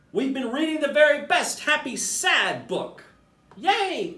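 guilty little monkey owning up to Mama. Acted. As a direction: confess it, then can't help cheering.

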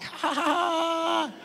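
slow, high, drawn-out taunting ha ha; sister's mocking laugh. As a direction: gloat like a big sister who just won. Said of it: "and she'd laugh".

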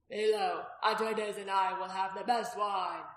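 loud and slow, for the whole room; loud announcement. A narrator's reading. Innkeeper announcing to the crowded room, loud and slow. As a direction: proclaim it so every table hears. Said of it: "he announced loudly enough to be heard".

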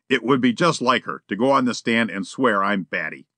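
ordinary delivery, nothing marked

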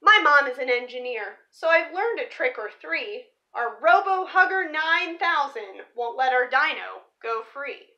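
lively picture-book read-aloud; story narration. Acted. Reading a dinosaur picture book to viewers, storybook voice. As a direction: read the rhyming story to the kids.

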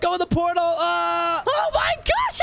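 high, shouted hurry; urgent shout. Acted. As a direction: herd everyone in, yelling. Rushing everyone through portal.

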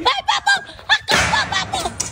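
high excited cheering. Acted.

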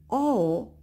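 usual speaking voice

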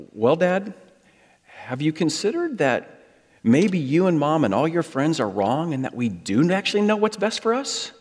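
lightly voiced know-it-all teenager, dead serious; dead serious teen. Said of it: "looks at me and dead serious".

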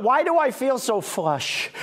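ordinary delivery

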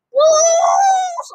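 very high loud yell; shouted boost call. Real, not acted. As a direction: belt it out. Shouting ability name.

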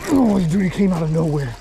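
high, loud burst that settles; real surprised exclamation; startled excitement. Real, not acted. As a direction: blurt it as the fish strikes. Fish suddenly strikes.

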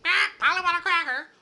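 high, loud squawking parrot. Pretend. Ordinary parrot begging for a cracker, high squawk. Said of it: "which would say".

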